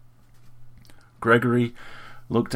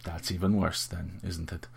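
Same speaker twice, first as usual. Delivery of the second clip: sniffy; 7 dB quieter than usual. A narrator's reading. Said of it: "she sniffed".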